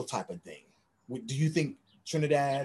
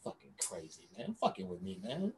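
breathless whisper to himself; whispered under breath; whispered aside; 8 semitones lower, 15 dB quieter than usual. Real, not acted. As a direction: drop to a near whisper. Muttered aside after week talk.

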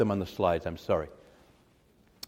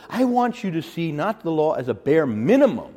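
ordinary talk, nothing marked

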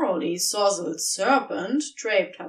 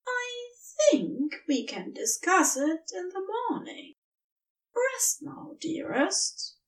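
tender; 9 semitones higher than usual. Acted. Tender goodnight to drunk serpent.

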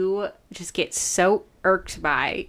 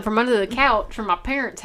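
ordinary talk, nothing marked

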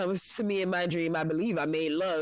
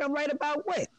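husband's confused question, mimicked much higher; mocking husband; 11 semitones higher than usual. Telling the story, she mimics her husband's confused reply. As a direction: imitate the husband, pitched way up. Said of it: "He like".

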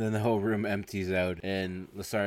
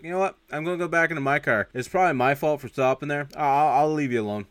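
higher, louder imitation of hasty retreat; mock nervous backing off; 5 semitones higher, 5 dB louder than usual. Angry driver retreating from Hightower, mock nervous. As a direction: back down, placating the bigger man.

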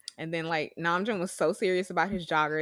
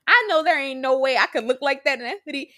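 usual speaking voice

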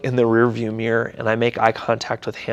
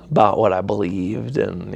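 usual speaking voice

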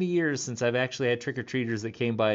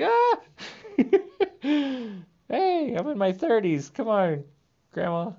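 squealed, laughing protest, much higher and louder; mock protest; 11 semitones higher, 4 dB louder than usual. Grandkid in her thirties protesting to grandma, squealing with laughter. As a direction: whine back at grandma through a laugh. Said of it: "I'm like".

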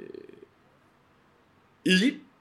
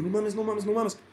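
panicky repeat; 9 semitones higher than usual. Retold or imitated. Panicking past self.

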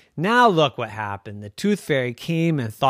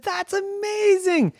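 put-on kid amazement. Children amazed.

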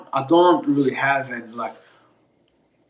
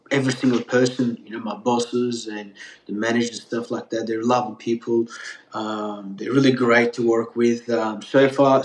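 usual speaking voice

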